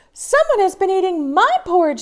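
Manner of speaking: high, quick, loud mama bear; a flustered mama bear voice. Acted. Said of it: "said Mama Bear".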